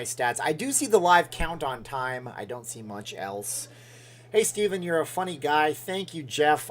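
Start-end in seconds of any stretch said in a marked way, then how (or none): none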